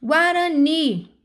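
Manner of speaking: teacher's demo, Guarani stressed at the end; stressed last syllable. Shown as a demonstration. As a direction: stretch the final NI of Guarani. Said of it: "a sílaba forte é ni".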